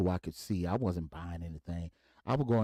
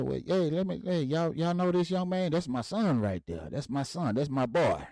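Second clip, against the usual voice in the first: proud dad bragging; 8 semitones higher than usual. Retold or imitated. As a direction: show off your boy to everyone. Dad shows off son to coworkers.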